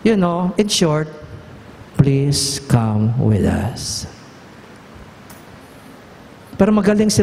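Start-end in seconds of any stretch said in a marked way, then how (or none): 1.9-4.1 low and very slow plea. Acted. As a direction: let the request hang, tender. Sums up Moses' plea softly.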